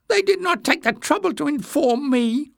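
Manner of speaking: very high, peevish snap; high-pitched irritation; snapping. A narrator's reading. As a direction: snap back in a squeaky voice. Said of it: "Jinx snapped".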